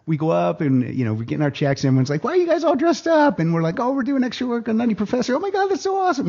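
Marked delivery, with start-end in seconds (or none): 2.2-3.4 high, bright curious question; curious coworkers. Retold or imitated. Coworkers at the pay office asking, high curious voice. 3.8-6.3 high gushing excitement; giddy bragging. Retold or imitated. Extras bragging to coworkers, high giddy gush.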